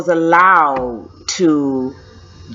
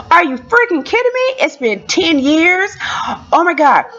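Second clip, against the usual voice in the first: high-pitched, upset outburst; reliving a tearful can't-believe-it moment; high, incredulous wail at the news; distraught disbelief; 9 semitones higher than usual. Retold or imitated. Relives crying at positive test.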